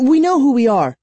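high, loud, flustered protest; stammering. A narrator's reading. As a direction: blurt it out nervously, pitched high. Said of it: "I stammered".

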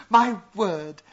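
excited. A narrator's reading. Simon excited by his find.

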